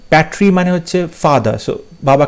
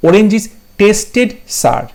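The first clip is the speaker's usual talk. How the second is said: each word slow and separate; slow, careful reading of an exam option; slow dictation; 5 semitones higher, much slower than usual. Shown as a demonstration. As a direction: read it out word by word for the class. Stating the right answer.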